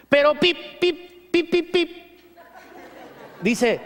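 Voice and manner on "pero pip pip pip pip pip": high squeaky beeps with a laugh; mock bleeped cursing. Preacher mimicking censored cursing, squeaky beeps. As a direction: bleep out the swearing.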